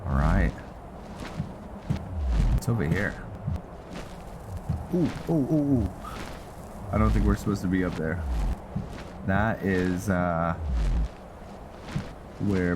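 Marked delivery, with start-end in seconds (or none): none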